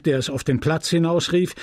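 plain reading voice

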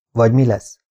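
usual speaking voice

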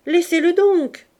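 a sharp voiced interjection across the workshop; loud Clémence. Clémence tells the mother to stop tapping, loudly. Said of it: "dit la grande Clémence".

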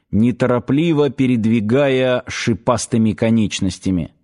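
ordinary delivery, nothing marked

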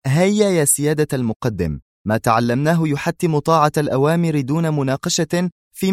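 put-on earnest, pressing young officer; urging a colleague. A narrator's reading. Officer urging a reluctant colleague away.

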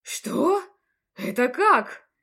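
loud startled question; baffled, caught off guard; taken aback. Acted. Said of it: "опешив". (ошарашенно)

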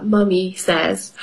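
ordinary delivery, nothing marked

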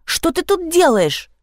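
high-pitched, drawn-out demand; shrieked question. A narrator's reading. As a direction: squeal the question indignantly. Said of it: "взвизгнула она". (визгливо, высоким голосом)

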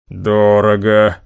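low, drawn-out disapproval; displeased drawl. A narrator's reading. Boss hearing the estimated price, displeased drawl. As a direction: stretch it out slowly and low, unimpressed. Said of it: "недовольно протянул Хосе".

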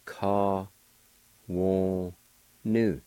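"ka, wo, nu" each syllable said slowly and apart; Cherokee syllables. Shown as a demonstration. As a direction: spell out "ka, wo, nu" one syllable at a time. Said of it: "The first part is pronounced like this".

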